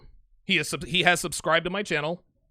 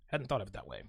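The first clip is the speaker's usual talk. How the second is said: much softer and lower, trailing off; quiet aside; 11 semitones lower, 13 dB quieter than usual. Real, not acted. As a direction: say it to yourself, almost dropping it. Repeats the thought to himself.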